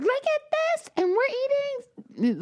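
very high-pitched imitation of excited children; happy little girls. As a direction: show off in a tiny kiddie voice. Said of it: "And they're happy".

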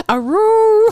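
high, loud laughing howl; wolf voice. Retold or imitated. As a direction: howl it like the dog. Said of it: "she just goes".

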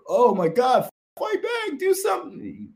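very high mock shriek of alarm; hammed-up, high-pitched pleading; alarmed onlooker. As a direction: act out a helpless bystander crying out.

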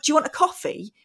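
high-pitched, pointed mock offer; retold prickly politeness; passive aggressive. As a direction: make the offer sound like a challenge. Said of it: "really passive aggressive with him".

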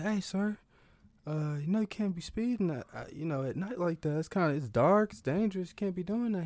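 high, polite cop warning; lightly voiced cop scolding. Cop warns speeding granddad at night, high voice.

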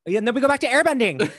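high, loud and fast; excited mocking echo. Real, not acted. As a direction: echo it with a laughing jab. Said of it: "repeated mockingly".

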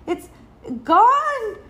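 high, loud cry of dismay; child's dismayed discovery; sad. A narrator's reading. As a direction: wail it mildly on the last word. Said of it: "she said sadly".

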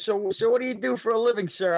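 high, sly mock interviewer; comic's mock-polite question. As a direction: bait him with fake politeness. Comedian questioning him on stage.

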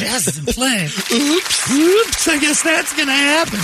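high, playful mock warning; teasing sing-song. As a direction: tease him with a sing-song threat. Voicing a teasing wife.

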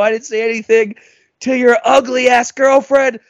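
imitating himself screaming at someone; retold angry yell. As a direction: hurl it back as an insult. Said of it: "I'm screaming at him".

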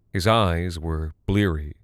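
plain reading voice

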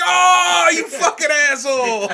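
high, loud mock outcry through laughter; laughing, hammed-up yell; retold shout. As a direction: imitate a crowd hollering in disbelief. Retold crowd cracking up in reaction.

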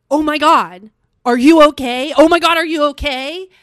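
loud mock-panicked check on someone; alarmed concern. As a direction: imitate a worried rescuer calling out. Voicing alarmed people finding her.

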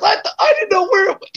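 high wailing mock sob, hammed up; mock crying. Brother Chris owning up onstage, hammed-up high crying. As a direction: whimper it like a guilty kid caught out. Brother's confession retold on stage.

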